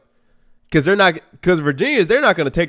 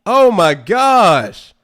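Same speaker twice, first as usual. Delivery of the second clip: exasperated; 3 semitones higher, 5 dB louder, much slower than usual. Real, not acted. Fan reacts to turnover.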